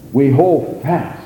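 ordinary talk, nothing marked

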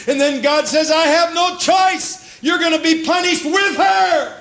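high, ringing preacher's drive; full-force preaching. Acted. Pastor stirring his church about the second coming, fervent. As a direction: lift the congregation with fervour.

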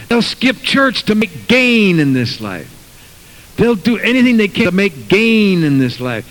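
full-force pulpit sermon; fiery preaching. Acted. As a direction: thunder the verse at the congregation. Reading scripture, preaching.

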